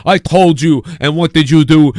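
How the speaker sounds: hammed-up told-you-so rant; gloating Sean. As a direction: puff up and crow it like a smug insider. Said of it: "he's gonna be like".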